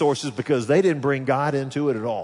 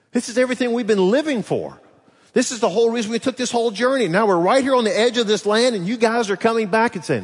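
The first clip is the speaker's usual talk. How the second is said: indignant outburst; 8 semitones higher, 4 dB louder, faster than usual. Acted. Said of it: "acting out Israelite frustration".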